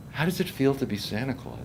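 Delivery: soft, sincere asking; hushed question. Retold or imitated. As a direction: drop to a quiet, touched voice. Architect's tender question after laughter.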